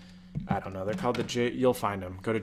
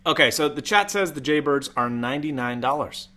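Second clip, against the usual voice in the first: ordinary talk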